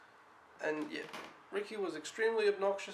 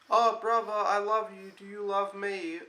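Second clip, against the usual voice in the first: higher-pitched mock little-kid pleading; imitating an affectionate child; 3 semitones higher, 5 dB louder than usual. Retelling the boy's good moments, affectionate child imitation. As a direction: play a little brother declaring love, higher and louder at first.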